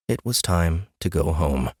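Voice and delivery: ordinary delivery, nothing marked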